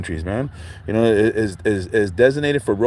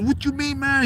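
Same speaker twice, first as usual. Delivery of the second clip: much higher flipped-out retort; mock outrage. Somali man flips at the cousin, high mock outrage. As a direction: play a man losing it. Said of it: "this fool flipped".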